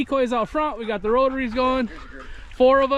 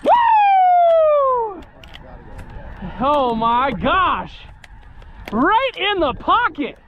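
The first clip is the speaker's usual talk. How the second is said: excited shouting; 8 semitones higher, much slower than usual. Real, not acted. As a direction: celebrate the shot at full voice. Celebrating after the volley.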